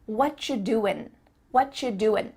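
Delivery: "doin'" ending on a plain n; casual clipped ending on "doing", an n; dropped G. Shown as a demonstration. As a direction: say "whatcha doin", no g. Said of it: "I end that word with an N".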